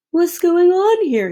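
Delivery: high, loud, slow cheerful call; boy calling out cheerfully from beneath. A narrator's reading. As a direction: call out happily from under the board. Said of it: "he called out cheerfully".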